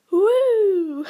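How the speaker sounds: loud, high mock whoop; sarcastic cheer. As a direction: cheer ironically about exams. Mock cheer for exam season.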